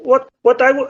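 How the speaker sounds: ordinary talk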